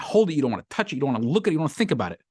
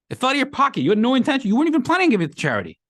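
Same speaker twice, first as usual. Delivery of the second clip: high, fast indignant objection; mock protest; 13 semitones higher, 5 dB louder, faster than usual. Objector disputes the sages' ruling, mock protest. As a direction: argue back in a high-pitched rush.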